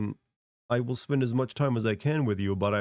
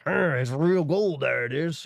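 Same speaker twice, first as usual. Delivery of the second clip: gold prospector; 5 semitones higher, 3 dB louder, slower than usual. Pretend. Prospector biting gold nugget.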